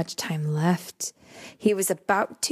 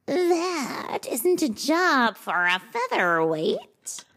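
slow, purring smug cat; 8 semitones higher, slower than usual. Acted. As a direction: purr it like a smug tabby. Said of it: "he purred".

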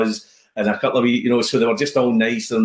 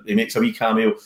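usual speaking voice

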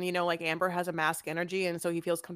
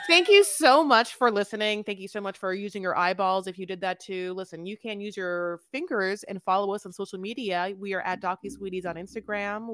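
podcast outro; 2 semitones higher, 5 dB louder than usual. Acted. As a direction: thank listeners warmly like a host. Outro thanks and plugs.

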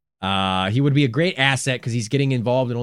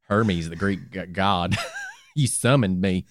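lower, slow cartoon voice; Cleveland impression; 9 semitones lower, 3 dB quieter, slower than usual. Host still doing Cleveland as Hermes, lower and slow. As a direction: slip back into Cleveland. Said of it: "I'm losing Cleveland".

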